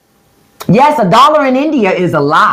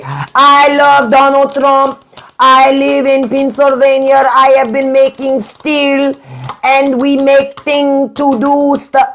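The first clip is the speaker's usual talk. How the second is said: higher, slow, stilted broken-English voice; mock a foreigner pretending to be American, higher and slow; mock foreign troll; 5 semitones higher, slower than usual. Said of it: "Americans don't talk like that".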